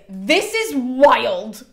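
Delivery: slow, drawn-out disbelief; stunned. Retold or imitated. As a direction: let each word hang in amazement. Elektra reacting, modern paraphrase.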